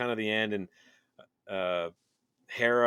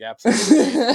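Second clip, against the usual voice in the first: loud, high emphatic yes; excited; 13 semitones higher, 13 dB louder than usual. Retold or imitated. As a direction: declare it with huge enthusiasm. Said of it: "It's like".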